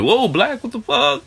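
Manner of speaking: high, startled gasp of disbelief; mock shocked reaction. Strangers reacting to him, high mock shock. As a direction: play a stranger caught off guard.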